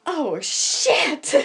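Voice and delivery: sudden high, loud startled swear; scared yelp on liftoff; panicked curse. Retold or imitated. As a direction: blurt it out in terror. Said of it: "out of my mouth comes".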